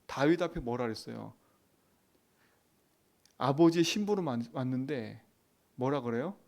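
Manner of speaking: normal talking voice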